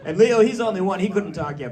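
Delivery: usual speaking voice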